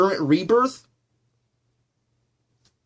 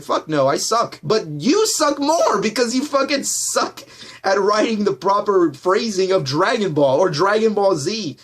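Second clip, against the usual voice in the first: normal talking voice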